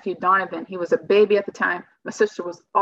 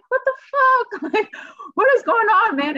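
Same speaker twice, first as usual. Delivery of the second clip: much higher and louder, a shocked laugh breaking through; shocked outburst; 4 dB louder than usual. Retold or imitated. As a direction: blurt it out high and loud, half-laughing in disbelief. Retold shock at dead man.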